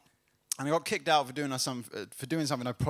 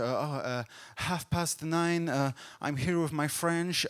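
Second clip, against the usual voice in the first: put-on slow accent drifting from Middle Eastern to Dutch; hesitant, wandering put-on foreign accent; shifting foreign accent; slower than usual. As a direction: grope through the answer as the foreign accent slips. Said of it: "gone from Middle Eastern to Dutch".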